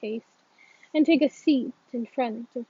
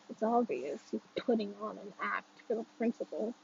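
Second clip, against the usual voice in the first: ordinary delivery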